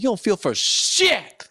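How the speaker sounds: high-pitched, slow comic outrage; indignant retort. Retold or imitated. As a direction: call them out in drawn-out mock outrage. Acted angry retort to mourners.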